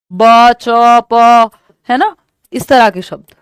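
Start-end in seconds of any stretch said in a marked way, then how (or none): 0.1-1.5 put-on loud, separate baby syllables ब, च, प; babbling sounds. Shown as a demonstration. Teacher showing students a baby's babbling, loud syllables. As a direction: call out ब, च, प like a babbling infant. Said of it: "six months में हम कहते हैं बैबलिंग".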